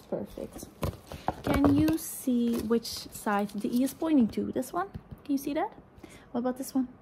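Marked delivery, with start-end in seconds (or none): none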